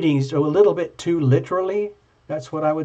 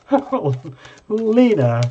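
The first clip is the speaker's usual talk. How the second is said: surprised; 7 semitones higher, 5 dB louder, much slower than usual. Real, not acted. Surprised reaction to card.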